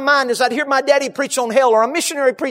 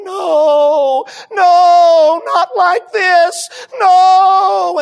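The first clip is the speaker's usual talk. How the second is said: high, loud, drawn-out wail of grief; retold anguished scream; 6 semitones higher, 6 dB louder, much slower than usual. Wife thrown over her husband's body, acted grieving scream. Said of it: "began to scream".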